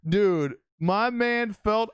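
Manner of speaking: high, loud and slow, real delight; excited greeting. Real, not acted. Streamer spotting his old friend Felt in chat, excited. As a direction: call out happily to a friend who just showed up.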